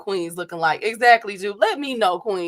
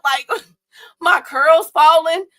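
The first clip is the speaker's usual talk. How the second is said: laughing; 7 semitones higher, 9 dB louder, slower than usual. Real, not acted. Laughing about sweating in heat.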